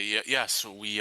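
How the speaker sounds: ordinary talk, nothing marked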